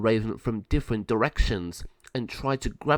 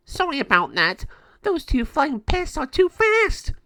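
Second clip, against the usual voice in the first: gargoyle apology; 6 dB louder than usual. Acted. Gargoyle apologising to El Jago, very high and loud. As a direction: whine it like a squeaky monster.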